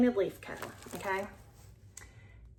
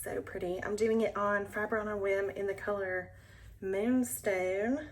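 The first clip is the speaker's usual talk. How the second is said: ordinary talk, nothing marked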